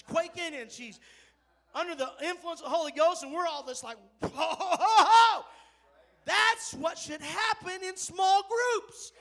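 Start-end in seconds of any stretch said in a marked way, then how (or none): 4.2-5.4 loud, high whoop with a laugh; joyful loud exclamation of wonder; amazed whoa. Real, not acted. Group amazed at tongues. 6.3-9.1 loud, slow, high preaching. Acted. Preaching climax.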